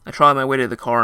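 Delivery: plain reading voice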